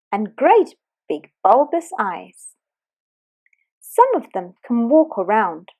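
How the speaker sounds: ordinary delivery, nothing marked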